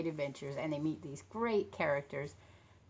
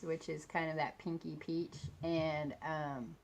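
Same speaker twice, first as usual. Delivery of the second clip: usual speaking voice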